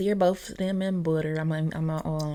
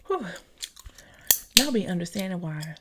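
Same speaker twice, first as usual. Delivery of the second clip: ordinary talk, nothing marked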